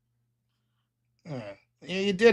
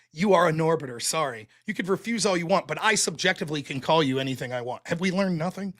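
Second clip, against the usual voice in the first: ordinary talk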